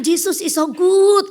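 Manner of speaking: high, loud, slow evangelist call; preacher's English. Retold or imitated. Foreign evangelist keeps preaching on the train, high slow call. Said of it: "Terus dia bilang".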